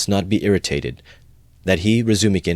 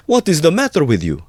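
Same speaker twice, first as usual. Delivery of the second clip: high, loud cry of a worried mother; alarmed cry; 12 semitones higher, 5 dB louder than usual. A narrator's reading. Mother to her son at his strange words, crying out. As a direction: cry out sharply higher, in alarm. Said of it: "cried his mother".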